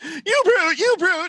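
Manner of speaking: high, wailing falsetto cry of outrage; hammed-up high whiny wail; mock offended cry. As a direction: shriek it like a scandalised lady. Mocking offended Brit over pitch.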